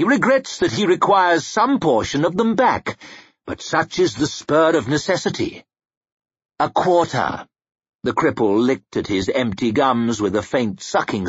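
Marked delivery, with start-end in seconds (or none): 0.0-7.5 higher, measured official announcement; lightly acted courtly official; cool royal spokesman. A narrator's reading. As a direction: announce the demand formally.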